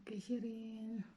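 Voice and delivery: soft whisper to the cat; whispering to the cat while she eats. Real, not acted. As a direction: whisper so as not to disturb her.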